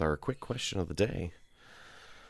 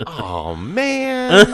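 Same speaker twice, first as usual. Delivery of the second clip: put-on high, louder letdown kid voice; disappointed kid; 11 semitones higher, 9 dB louder than usual. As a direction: groan it like a let-down child. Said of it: "little me would be like".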